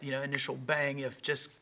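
ordinary talk

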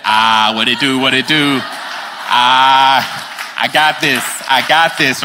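low, loud cocky swagger voice; Justin Riccio. Retold or imitated. Peter stepping onto the water, swaggering cocky bravado. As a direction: strut it out with big confidence. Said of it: "Talk like Justin Riccio a little bit".

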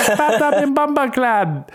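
high, slow mock-Jamaican gibberish; bad Jamaican accent. Comic retrying the Jamaican nurse's voice, bad Jamaican accent. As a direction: ham up a badly faked Jamaican accent. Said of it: "she sounds Irish now".

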